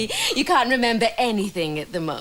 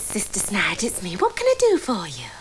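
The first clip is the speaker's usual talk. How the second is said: ordinary talk, nothing marked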